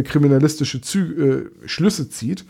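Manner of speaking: normal talking voice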